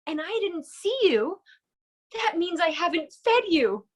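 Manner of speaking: amazed at first, then the guilt dawns; awed then alarmed Noah. Acted. Noah realizing he never fed the phoenix, in rising dismay. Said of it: "And Noah's like".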